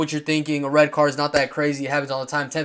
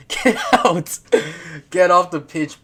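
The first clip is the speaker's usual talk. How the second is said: laughing mock shout; 3 semitones higher, 4 dB louder, slower than usual. Vlogger laughing while mimicking Keane sending Gueye off. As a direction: giggle through a mock send-off.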